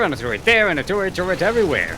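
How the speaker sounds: gleeful turret chant; singsong excitement. Acted. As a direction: rattle it off giddily. Mocking turret spam, singsong.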